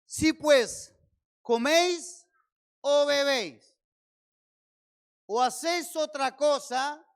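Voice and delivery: loud, slow, emphatic scripture read; full-force preacher declaiming; fiery preaching. Acted. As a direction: thunder the verse out to the church.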